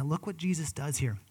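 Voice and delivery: ordinary delivery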